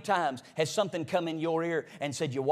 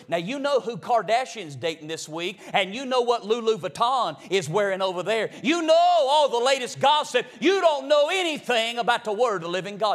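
climbing higher and louder toward a shout; building preacher's call; 6 semitones higher, 7 dB louder than usual. Acted. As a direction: build the rebuke to a loud peak.

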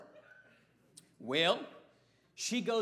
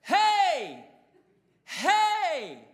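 loud, high repeated shouts; imitating a cop hollering hey; policeman yelling; 8 semitones higher, 11 dB louder, much slower than usual. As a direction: holler to wake a passed-out man. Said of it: "the policeman's going".

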